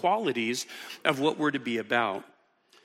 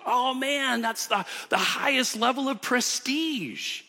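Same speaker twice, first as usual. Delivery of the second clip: mock awed, pitched higher; mock impressed; 7 semitones higher than usual. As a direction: gush as if a waiter were royalty. Imagined admirer of a waiter.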